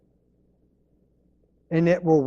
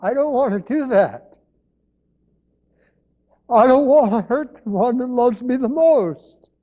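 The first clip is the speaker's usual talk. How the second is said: voice rising with emotion; 7 semitones higher than usual. Real, not acted. Preacher emotional about hurting Jesus.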